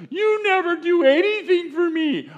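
mock a sulking toddler; whiny toddler. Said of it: "the toddler who says".

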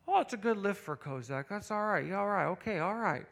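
softly voiced coach's running commentary; coach's narration. Coach commenting on an athlete's lift, mild narration voice. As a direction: mumble along like an underwhelmed coach narrating. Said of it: "doing this little narration thing".

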